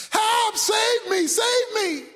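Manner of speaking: high, urgent shouting; screamed plea. Acted. Man on the branch to God, high screamed pleading. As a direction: beg loudly to be rescued. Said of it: "and he screamed".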